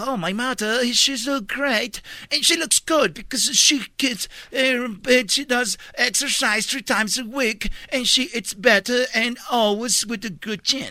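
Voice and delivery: slow Mexican-accented English impression; mock English accent. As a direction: slowly imitate an English speaker. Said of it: "pero en inglés, dijo".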